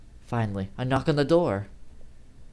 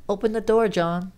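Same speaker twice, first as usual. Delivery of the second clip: high, louder friendly plea; coaxing female voice; 9 semitones higher, 6 dB louder than usual. Acted. Amy at the door urging John, high coaxing. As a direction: coax him to open up. Amy asks him to open.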